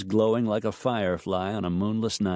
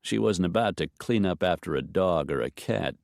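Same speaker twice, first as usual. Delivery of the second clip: ordinary delivery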